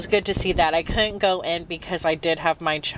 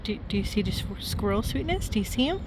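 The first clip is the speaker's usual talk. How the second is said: soft, high-pitched coaxing questions; 3 semitones higher, 5 dB quieter, faster than usual. Real, not acted. Asking her child about the squirrel, soft high baby talk. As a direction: coo softly, lifting each question upward.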